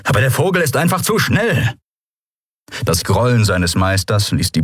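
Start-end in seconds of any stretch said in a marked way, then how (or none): 0.0-1.7 complaining. Acted. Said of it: "beschwerte sich Telbo".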